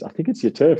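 hint of a chirpy friend pointing it out; lightly voiced husband. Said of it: "He goes".

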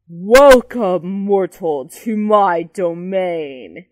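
slow, drawn-out monster welcome, loud at the start; deep booming yell. A narrator's reading. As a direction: yell it like a creature from the depths. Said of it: "he yells in a voice so deep".

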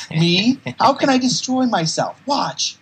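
high, loud manic cry; manic shout. Retold or imitated. Patient yelling at Cliff, high and loud. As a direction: shout it like a mad patient showing off.

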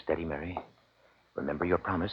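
low, soft murmured caution; a father quietly steadying his daughter; hushed. Acted. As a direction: keep it down so no one hears. Father hushing daughter at ceremony.

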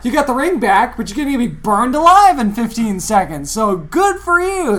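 high, loud mocking congratulations; sarcastic sing-song; mock cheer. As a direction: taunt Gollum with fake cheer. Mocking Gollum in the fire.

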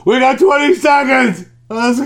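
high, loud hurried call; mock producer shout. Producer calling out the time before the break, loud and high. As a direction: yell the countdown like a frantic producer. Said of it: "the one producer goes".

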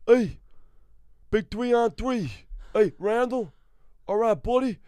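high, strained mumbling buddy voice; Milo Ventimiglia impression. Greeting a pal at the bar, high and strained. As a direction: act the friendly actor, pitched high.

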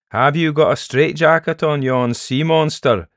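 high, loud Scots jibe; mocking Scottish peddler. Peddler poking fun at the sea monster, mocking. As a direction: taunt the elder in a Scots voice.

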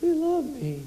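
put-on like a kid finding the valentine; child at the Valentine box, breathless and thrilled; giddy child delight. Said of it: "it's like".

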